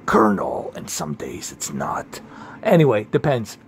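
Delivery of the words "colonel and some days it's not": a hint of low whispered Snake; half-dropping into the Snake whisper. Shown as a demonstration. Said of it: "my Snake voice is good".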